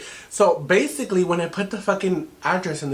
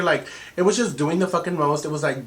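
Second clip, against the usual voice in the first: ordinary delivery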